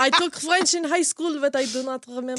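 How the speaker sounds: laughing French accent. Real, not acted. Host admitting forgotten French, laughing in accent.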